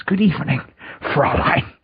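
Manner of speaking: lightly voiced German villain; German accent. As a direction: greet her like a sly German officer. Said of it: "turn into that Nazi from Indiana Jones".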